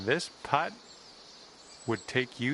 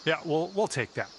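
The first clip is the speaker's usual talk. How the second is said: calm golf broadcast voice; golf commentator. Acted. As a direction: call the shots like TV golf. Golf game commentary throughout.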